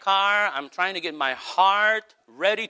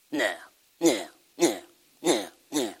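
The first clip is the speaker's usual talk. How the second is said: short, dismissive mock scoffs; mock bad attitude; 7 semitones lower, 3 dB quieter than usual. Preacher imitating an overcritical churchgoer, mock dismissive attitude. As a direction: imitate a sour critic brushing it all off. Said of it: "come here with an attitude and say".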